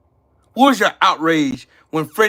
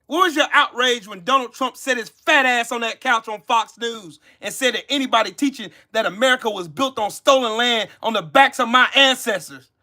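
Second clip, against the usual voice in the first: normal talking voice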